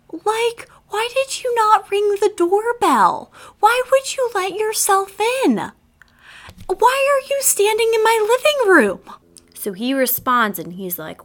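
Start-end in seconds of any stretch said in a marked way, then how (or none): 0.1-9.0 indignant questions. Retold or imitated. Confronting intruder in her house.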